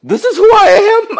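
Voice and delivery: high, loud mock despair; exaggerated dismay climbing to a squeal; discouraged outburst. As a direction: wail it in hammed-up disbelief, stretching the words. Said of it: "I was so discouraged 'cause I'm like".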